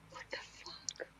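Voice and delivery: barely audible, whispered disbelief; hushed shock under the breath; stunned whisper. Real, not acted. Stunned reaction to the clip.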